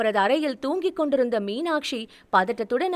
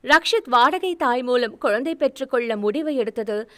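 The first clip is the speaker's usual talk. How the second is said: plain reading voice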